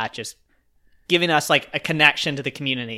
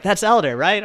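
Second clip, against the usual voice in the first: higher, louder mimicry of a fan; raised, eager voice of a retold fan; imitated excited fan; 8 semitones higher, 5 dB louder than usual. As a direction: act out a stranger checking who they've spotted. Imitates fan recognising his friend.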